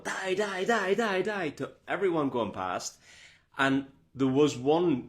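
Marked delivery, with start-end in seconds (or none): 0.0-1.5 high, urgent chant; rapid repeated high calls; retold cheering. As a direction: imitate yourself cheering runners on, pitched well up. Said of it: "I was going".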